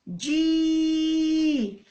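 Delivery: long held جي; drawn-out syllable ji. Shown as a demonstration. Teacher modelling the kasra syllable, held long. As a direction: stretch out جي. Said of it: "جي واش تولي".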